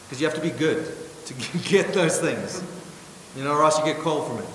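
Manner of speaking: ordinary talk, nothing marked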